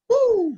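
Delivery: very high, loud mock-crying whoop; weird crying noise. New dad trying to cry, imitated loud high whoop. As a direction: let out a sudden high hoot. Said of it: "That was weird".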